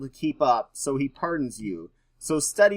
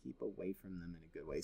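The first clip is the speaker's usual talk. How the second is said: much softer, faster, half to himself; quiet murmured repeat; 6 semitones lower, 18 dB quieter, faster than usual. Shown as a demonstration. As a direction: mutter the phrase again under your breath.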